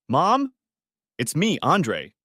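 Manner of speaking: calls out to mom from the doorway; calling out. A narrator's reading. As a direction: raise the voice to reach the next room. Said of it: "called out".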